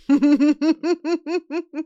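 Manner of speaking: loud high mad laugh; diabolical laugh. Real, not acted. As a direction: laugh like you're taking over the world. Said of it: "That's like your diabolical laugh".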